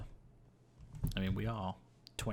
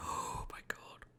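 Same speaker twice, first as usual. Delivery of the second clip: gasped whisper; 5 dB quieter than usual. Real, not acted. Sudden fall danger.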